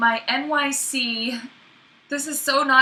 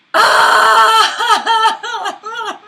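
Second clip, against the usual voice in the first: loud high shrieking laugh; stressed laugh; 11 semitones higher, 9 dB louder than usual. Real, not acted. Rushing to meet her casting deadline, shrieking laugh. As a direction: burst out in a frantic laugh.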